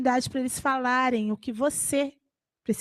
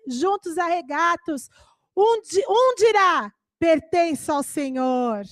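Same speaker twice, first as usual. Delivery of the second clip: raised, measured Bible reading voice; scripture reading; 6 semitones higher than usual. Acted. Preacher reads Isaiah aloud to the church, solemn scripture reading. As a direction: proclaim the verses slowly to the church.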